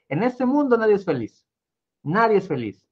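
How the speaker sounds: high, proud royal declaration; king's proclamation. A narrator's reading. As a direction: announce it like a haughty king. King declaring nobody is happy.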